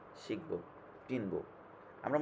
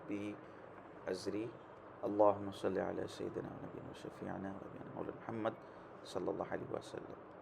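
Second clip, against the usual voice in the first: soft, even recited Arabic prayer; quiet devotional chant; prayer recitation; 3 semitones lower, 6 dB quieter than usual. Acted. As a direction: recite the opening duas gently. Opening duas recited softly.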